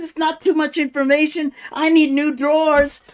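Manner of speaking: high, loud mock indignant retort; imitated outraged demand; extremely irate. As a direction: argue back as an irate detainee. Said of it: "this guy was extremely irate".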